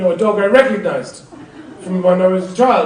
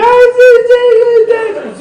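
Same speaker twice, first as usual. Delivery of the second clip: put-on high, louder stammering sobs of a child; sobbing child; 5 dB louder, slower than usual. Father imitates his upset children with high stammering sobs. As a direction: mimic a distraught kid choking on words. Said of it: "They were going".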